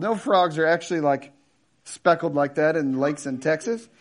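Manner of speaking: ordinary delivery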